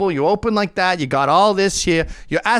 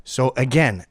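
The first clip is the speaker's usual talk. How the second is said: usual speaking voice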